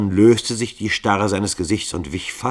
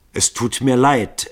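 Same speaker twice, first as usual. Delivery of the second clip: apologetic; 8 semitones higher, 7 dB louder than usual. A narrator's reading. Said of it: "entschuldigte sich Starkington".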